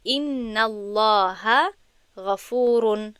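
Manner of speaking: slow, careful Arabic recitation of «ان الله غفور» with weight on الله; slow Arabic example. Shown as a demonstration. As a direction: read out very slowly, stressing الله. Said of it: "مثال".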